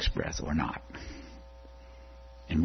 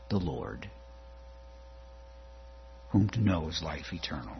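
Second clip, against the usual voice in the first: ordinary talk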